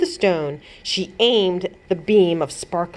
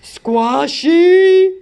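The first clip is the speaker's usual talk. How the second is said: loud bellowing giant voice; louder giant bellow, drawn out; giant yelling; 10 semitones higher, 7 dB louder than usual. A narrator's reading. Toothless giant bellows at the heroes, loud. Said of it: "The giant yelled".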